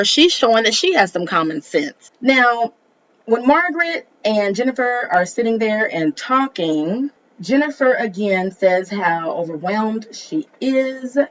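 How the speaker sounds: ordinary talk, nothing marked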